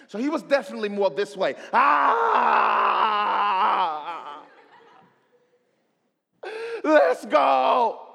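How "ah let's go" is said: high, loud hype shout; showing off to the whole gym; loud gym bro shout. Retold or imitated. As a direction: yell it across the gym. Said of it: "loud and boisterous".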